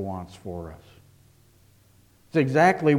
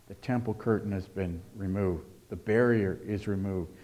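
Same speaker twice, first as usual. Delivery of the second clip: usual speaking voice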